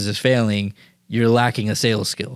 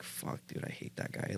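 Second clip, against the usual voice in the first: whispered disgust; 22 dB quieter than usual. Retold or imitated. Man seeing his gut in the mirror, muttering in a whisper. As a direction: whisper it bitterly under your breath.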